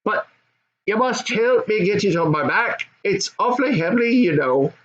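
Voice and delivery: put-on high young goblin son. Goblin son answering his dad, high and eager.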